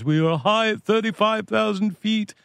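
a quick hint of [name]. Quoting [name] on first coming to the States, lightly voiced. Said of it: "And he goes".

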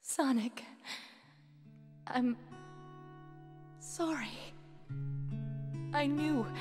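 faltering apology with long gaps; dying, weak. Acted. Dying genie apologising to Sonic, weak and halting. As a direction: force out each word with failing breath.

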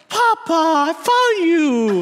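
high, drawn-out cry of reunion; hammed-up child's delight, stretched long and high; overjoyed toddler daughter. Baby daughter grabbing her lost pacifier, hammed-up high joy. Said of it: "M J grabs it and goes".

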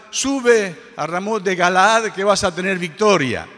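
loud, high, rousing cry of victory; prophetic proclamation. Retold or imitated. Prophets urging the king to battle, loud triumphant proclamation.

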